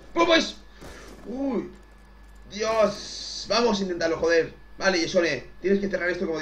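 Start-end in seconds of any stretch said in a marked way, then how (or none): 0.1-3.5 excited exclamations. Real, not acted. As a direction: cheer and gasp at the action.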